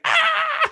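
high loud laughing shriek; burst of laughter. Retold or imitated. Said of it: "two people like".

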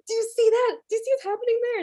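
gasping, high-pitched excited cry; frantic "are you seeing this" squeal; screaming at sister. Retold or imitated. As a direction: shriek it in scandalized excitement. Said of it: "screaming at my sister".

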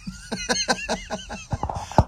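high wheezing cackle; real laugh. Real, not acted. Comic amused by his own warlord bit, real high laugh. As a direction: crack up with a squeaky, pulsing laugh.

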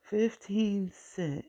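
amazed disbelief. Real, not acted. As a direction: savour 'Fifteen cent' like it can't be true. Marvels at cheap salami price.

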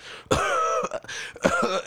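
very high-pitched mock cough; imitated coughing. Imitating the customer's wife over there, coughing. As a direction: act out someone hacking away.

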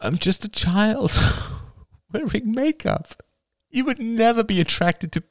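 high, breaking, tearful outpouring; distraught confession. Acted. Rachel confesses her lies and attraction to Oliver, distraught. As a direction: blurt each admission out, voice climbing and catching.

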